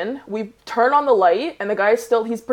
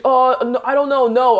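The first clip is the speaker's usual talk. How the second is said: louder, higher mock fluster; flustered denial; 4 semitones higher, 5 dB louder than usual. Cornered intruder answering the camera owner, flustered. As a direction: scramble to deny it, imitating someone caught out.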